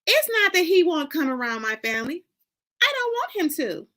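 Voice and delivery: plead, voice rising at the end; pleading daughter. Acted. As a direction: daughter pushing back, high and pleading. Shannon admits shame to Honey.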